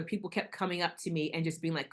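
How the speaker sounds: ordinary talk, nothing marked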